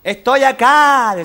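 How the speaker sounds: slow high shout. Acted. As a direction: shout it slowly into the cave. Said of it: "así gritaba el médico".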